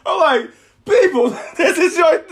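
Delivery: high, loud laughing disbelief; cracking up while ranting. Real, not acted. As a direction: laugh through the outrage. Laughing rant about Trump clip.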